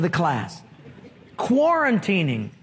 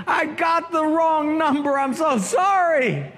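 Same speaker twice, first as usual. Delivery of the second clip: embarrassed apology; 7 semitones higher than usual. Acted. Apologizing for wrong number.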